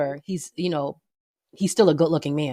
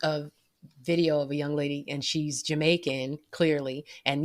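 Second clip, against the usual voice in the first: usual speaking voice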